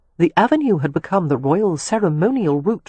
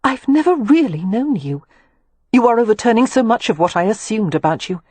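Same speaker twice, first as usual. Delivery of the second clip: bursting out; 5 semitones higher than usual. Acted. The lady finally bursts out to Leo after a silence, agitated and higher. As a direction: blurt it out, flustered and unsettled. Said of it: "before Catherine burst out".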